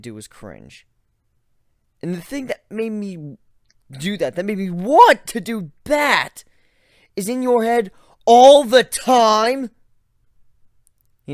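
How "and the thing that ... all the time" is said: much higher, louder, slowing at the end; horrified shout. Acted. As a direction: shriek in disgust. Horrified outburst continuing.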